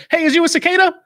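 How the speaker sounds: high, loud call down into a hole; yelling down a hole. Retold or imitated. Survivalist shouting into a hole in the ground, loud and high. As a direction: holler it down the hole like someone might answer. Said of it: "I yell down in there".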